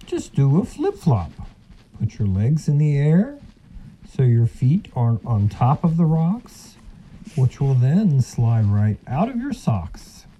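sly silky fox. Acted. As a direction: announce it like a smug fox. Fox boasts solution.

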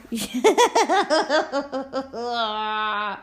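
a long real laugh. Real, not acted. Joking that nobody prayed with her, a long laugh. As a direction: laugh freely at your own joke.

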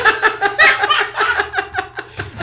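loud high cackle; high burst of laughter. Real, not acted. Telling friend the breakup story, bursting into laughter.

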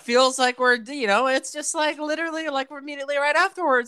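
silly high-pitched podcast voice; high put-on voice. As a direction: pitch it way up like a hype host. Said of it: "I don't know why I'm talking like this".

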